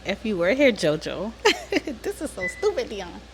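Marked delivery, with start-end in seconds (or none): 1.4-2.0 embarrassed laugh. Real, not acted. Sister addressing late brother on camera, embarrassed giggle. 2.0-3.2 soft, high, laughing words; embarrassed giggling. Real, not acted. Sister protesting to interviewer on camera, embarrassed giggling.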